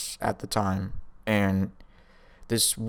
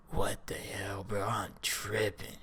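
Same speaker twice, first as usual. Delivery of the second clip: much softer, unvoiced whisper, slow at the end; stunned whisper; 12 dB quieter, slower than usual. Reacting to hallucinations, stunned slow whisper. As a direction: breathe it out in disbelief. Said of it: "I'm just like".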